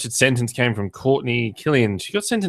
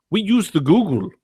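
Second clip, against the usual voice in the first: put-on high, lightly accented "We use the Google"; scammer's accent, lightly done; mock thick accent; 9 semitones higher than usual. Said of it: "the guy had a very thick accent".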